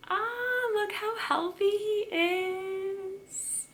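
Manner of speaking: high, drawn-out singsong gush; slow, high-pitched doting coo; cooing delight. Real, not acted. Plant lover fawning over her banana tree, cooing in delight.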